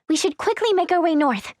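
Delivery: ordinary talk, nothing marked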